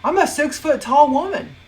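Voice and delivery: high, loud outraged retort; indignant, voice jumping up; indignant protest. Acted. Indignant retort about the boss.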